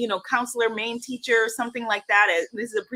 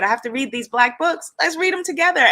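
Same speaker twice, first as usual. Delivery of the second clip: higher, louder, eager teacher voice; 7 semitones higher, 4 dB louder than usual. Retold or imitated. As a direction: lift "Let's read them together" high and emphatic.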